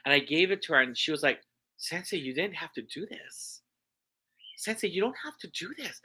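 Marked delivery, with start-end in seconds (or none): 1.8-6.0 soft, touched protest from the student; gently overwhelmed, hushed; tone completely changed. Retold or imitated. Student to teacher on receiving a gift, soft and touched. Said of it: "her whole tone completely changed".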